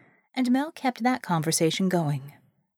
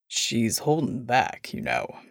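low, slow gruff voice; low and unhurried, like a big man; deep gruff voice; 10 semitones lower, slower than usual. Acted. Bull's rare wry line.

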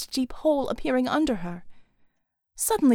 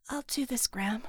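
quiet promise; 8 dB quieter than usual. A narrator's reading. Quiet vow to her grandmother.

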